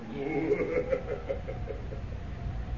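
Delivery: breathy ghostly laughter; a faint, hollow laugh from offstage; eerie whispered laugh. Acted.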